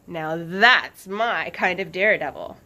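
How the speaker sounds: loud, admiring, with a mischievous smile. Acted. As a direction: say it boldly, not keeping your voice down. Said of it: "said with a mischievous smile".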